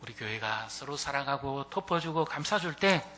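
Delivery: loud fervent sermon climax; preaching at full force. Acted. Pastor exhorting the congregation with full force. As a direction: drive the words home to the church.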